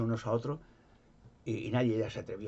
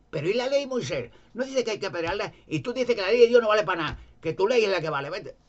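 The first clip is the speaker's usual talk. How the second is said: indignant retort; 9 semitones higher, 6 dB louder than usual. Retold or imitated. Pharisees' imagined angry retort.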